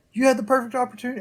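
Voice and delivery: exasperated viewer. Retold or imitated. Viewer venting at the episode in exasperation. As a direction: blurt it at the screen in disbelief. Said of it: "And you're sitting there like".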